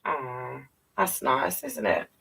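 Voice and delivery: usual speaking voice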